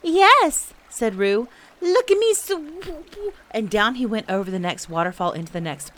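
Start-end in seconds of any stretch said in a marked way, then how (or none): 0.0-0.7 high, loud little squeak; tiny excited joey voice; squeaking Roo. A narrator's reading. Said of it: "squeaked Roo". 1.8-2.5 very high, loud squeal, cut short; excited little joey voice; squeaking Roo. A narrator's reading. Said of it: "squeaked Roo".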